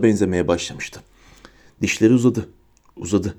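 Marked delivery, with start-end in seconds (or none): none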